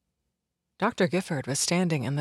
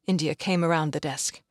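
plain reading voice

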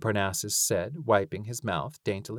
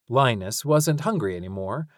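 ordinary delivery, nothing marked

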